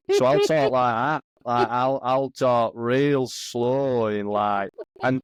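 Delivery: slow right down into a Doncaster-style Yorkshire accent. Shown as a demonstration. Geordie showing lads how he talks in America, slow Yorkshire accent. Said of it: "I sometimes fall into, like, a Yorkshire accent".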